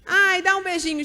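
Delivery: mock-cooing parent; doting parent. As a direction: egg the toddler on sweetly. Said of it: "o pai com a mãe ... fala".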